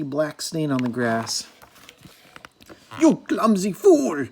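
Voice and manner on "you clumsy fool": high, loud, slow furious screech; the disguised queen screeching at Angry, loud and high. A narrator's reading. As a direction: screech it like a wicked crone. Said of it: "screeched the old lady".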